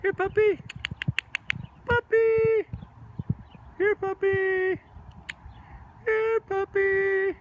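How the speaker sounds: very high, drawn-out pet call; calling a lost pet. Pretend. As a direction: coax the animal over, slowly.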